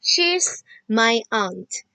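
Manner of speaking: very slow and distinct; a teacher reading the model answer to children. Shown as a demonstration. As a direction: spell out each word with your voice. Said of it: "petik atas".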